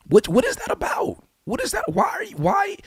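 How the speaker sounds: squeaky high disbelieving why; incredulous. Real, not acted. Asking Drake why, squeaking in disbelief.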